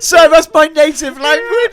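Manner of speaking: laughing mock outrage. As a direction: crack up while playing offended.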